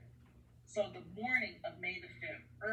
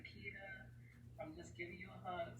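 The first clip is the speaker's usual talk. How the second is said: softer, quicker gentle mother; a mother soothing her sleepy child, softly; softly voiced mom, a little quick; 10 dB quieter than usual. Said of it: "my mom always had that soft voice".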